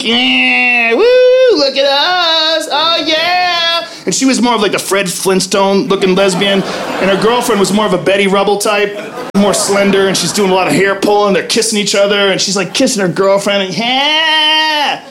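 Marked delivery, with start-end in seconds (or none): 0.0-3.8 high, drawn-out mocking whoop; drunken taunting. Drunk woman flaunts her girlfriend at onlookers, mock taunting. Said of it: "those weird taunting things". 13.8-15.0 high, stretched-out mocking yeah; taunting. Woman kissing her girlfriend at the men, mock taunting. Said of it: "taunting us".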